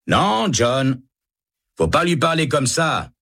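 high, firm protest; rebuking guard. Acted. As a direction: stop your colleague from insulting him. (voix haute qui reprend un collègue)

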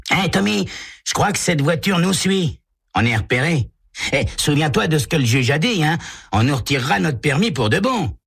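put-on high boyish voice, uneasy; a worried kid warning his buddy; nervous teen. Walt warns Tommy the car follows them, high and uneasy.

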